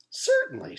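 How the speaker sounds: high, airy sing-song certainly; sugary teacher reply; lilting teacher. Acted. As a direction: chirp it sweetly. Teacher answers cheerfully.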